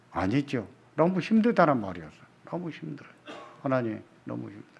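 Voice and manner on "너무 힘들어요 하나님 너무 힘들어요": soft, drained plea to God; quiet exhausted praying; weary hushed prayer. Acted. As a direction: sigh it out, worn down.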